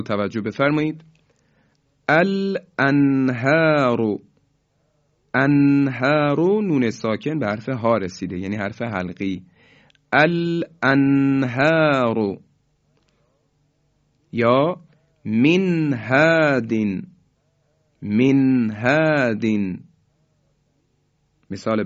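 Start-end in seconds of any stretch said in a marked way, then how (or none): 2.1-6.6 slow recitation, nun sounded clearly with no hold; Quranic recitation, clear izhar. Shown as a demonstration. Teacher reciting the correct examples, slow clear izhar. 10.1-19.8 slow recitation, nun sounded clearly with no hold; Quranic recitation, clear izhar. Shown as a demonstration. Teacher reciting the correct examples, slow clear izhar.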